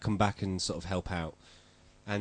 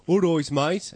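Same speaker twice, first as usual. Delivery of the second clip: higher, louder Birmingham greeting; Birmingham accent; 6 semitones higher, 7 dB louder, slower than usual. Shown as a demonstration. Host showing newcomers' idea of local speech, Birmingham accent. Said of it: "This is a Birmingham accent".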